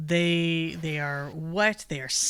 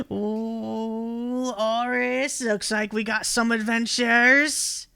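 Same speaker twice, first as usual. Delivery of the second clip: high, louder croaky toad voice; toad folk voice; 7 semitones higher, 6 dB louder, much slower than usual. Acted. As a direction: play a gleeful toad creature greeting strangers. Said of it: "and goes".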